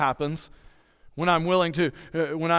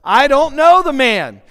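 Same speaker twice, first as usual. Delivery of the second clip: higher, louder and slow, as Peter swears; cursing denial; 8 semitones higher, 9 dB louder, slower than usual. Retold or imitated. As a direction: spit out a denial under pressure. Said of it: "he began to call down curses".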